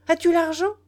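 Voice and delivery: high, loud demanding question; sharp challenge. A narrator's reading. Nana challenges the boy for money, sharply. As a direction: demand it, knowing the answer.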